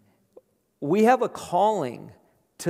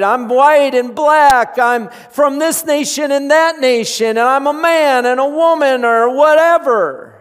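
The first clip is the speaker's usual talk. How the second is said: loud, high, fervent preaching to the congregation; full-force preaching; 9 semitones higher, 10 dB louder than usual. Acted. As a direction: preach it out, rising and pressing.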